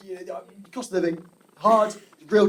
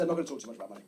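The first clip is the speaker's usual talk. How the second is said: usual speaking voice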